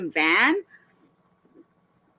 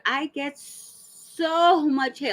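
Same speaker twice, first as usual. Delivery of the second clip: drawn-out, higher mock lament; mock-wounded reading; 4 semitones higher, much slower than usual. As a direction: read her post out slowly, overdramatic. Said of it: "the next sentence she put".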